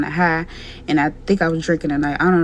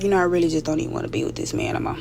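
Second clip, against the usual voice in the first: inner voice; 3 semitones lower, 7 dB quieter, faster than usual. Retold or imitated. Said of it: "So I had said in my mind".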